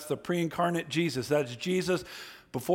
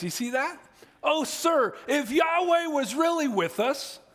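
higher, imploring voice of a bewildered man; pleading Gideon; 7 semitones higher than usual. Acted. Gideon speaking to the unknown angel, higher and imploring. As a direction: beg the stranger like Gideon, pitched up.

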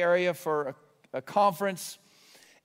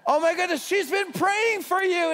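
high, loud burst of delight; high-pitched gushing joy; overjoyed exclamation; 11 semitones higher, 4 dB louder than usual. Retold or imitated. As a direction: exclaim it like wonderful news. Overjoyed reply to driver.

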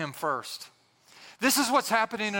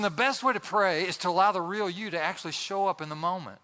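ordinary talk

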